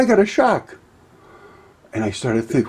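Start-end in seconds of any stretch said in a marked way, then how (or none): 0.0-0.8 wife complaining. Retold or imitated. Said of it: "She said".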